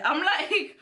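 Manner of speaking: ordinary talk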